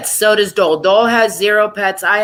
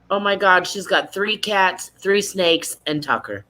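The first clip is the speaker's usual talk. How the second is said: usual speaking voice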